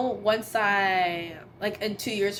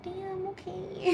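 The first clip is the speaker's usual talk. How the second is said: taken aback; 9 semitones higher, 7 dB quieter, much slower than usual. Retold or imitated. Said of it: "I'm like".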